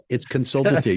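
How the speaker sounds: consultative stressed on SUL, con-SUL-ta-tive; consultative, second syllable stressed, as a gentle correction; corrected stress. Shown as a demonstration. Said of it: "You have the emphasis on the wrong syllable".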